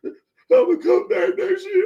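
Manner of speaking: high, slow, whiny fake sob, rising to a wail; mock crying whine. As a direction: imitate a player crying over a loss. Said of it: "I don't need him crying and saying".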